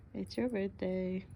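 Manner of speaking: normal talking voice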